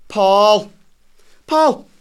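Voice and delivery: loud, rising shouts of a name; calling out. Acted. Calling out for his brother.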